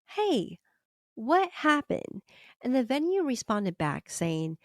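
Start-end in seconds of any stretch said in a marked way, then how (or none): 0.0-2.2 higher-pitched, drawn-out mock query; puzzled inquiry. Another creator emails the venue, asking in puzzled disbelief. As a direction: gently quote a puzzled question, pitched up.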